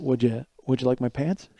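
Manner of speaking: hushed, deadpan offer leaned in close; quiet offer. Retold or imitated. Leaning over to the girl in shorts, offering his coat, hushed and deadpan. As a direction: murmur it politely right by her ear.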